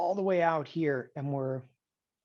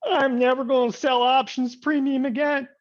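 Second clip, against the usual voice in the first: frustrated trader; 7 semitones higher, 7 dB louder than usual. Retold or imitated. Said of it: "And somebody goes".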